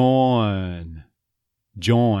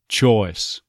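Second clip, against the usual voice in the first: Choice with the oi second half flattened; Texas choice. Shown as a demonstration. An accent coach demoing Choice in Texas. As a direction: say Choice as chawce. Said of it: "second half of the oi".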